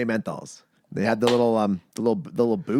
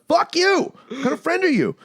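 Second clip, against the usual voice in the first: mock outrage. Friend pushes cigarette, he retorts.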